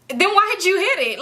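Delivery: can't believe what he just heard; incredulous. Real, not acted. Incredulous question at Uber driver.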